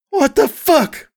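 high-pitched, outraged screech. A narrator's reading. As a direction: screech it out in shock, pitch shooting up. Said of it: "I screeched".

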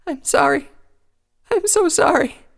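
high, loud, breaking apology; sorry through rising tears; tearful apology. Acted. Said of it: "Fresh tears poured from her eyes".

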